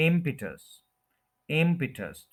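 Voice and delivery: Impetus as IM-pi-tus; impetus falling away from a stretched IM; stress on first syllable. Shown as a demonstration. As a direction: lean on IM in impetus, short pi. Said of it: "first के ऊपर stress है".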